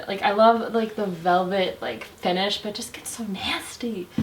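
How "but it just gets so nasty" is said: breathy disgusted whisper aside. Real, not acted. Beauty vlogger whispers her disgust at the messy palette. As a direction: whisper it like a grossed-out secret.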